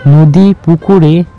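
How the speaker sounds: ordinary delivery, nothing marked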